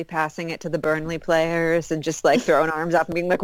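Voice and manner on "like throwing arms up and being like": ordinary talk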